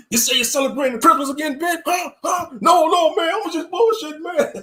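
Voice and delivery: high, shouted fake accusation that turns to a joke; mock confrontation. Friend playfully confronting Ben about Christmas, mock shout. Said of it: "teasing, acted confrontation".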